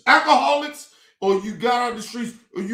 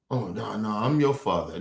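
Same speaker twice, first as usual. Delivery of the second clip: much lower and softer, a mock fatherly voice; mocking his dad; 11 semitones lower, 4 dB quieter than usual. Mimicking his dad claiming to be his father, low mock voice. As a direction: imitate the dad, low and smug. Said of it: "my own dad and shit sitting there acting like".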